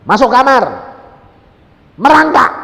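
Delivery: high, loud, separated orders; imitated shouted command. Preacher relaying instructions he heard to the woman, shouted command. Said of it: "aku cuma niruin".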